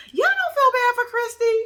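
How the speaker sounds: very high-pitched, pleading question. Real, not acted. Vlogger begs viewers to pity poor Christy, high-pitched pleading. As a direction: shoot the voice right up, appealing for sympathy.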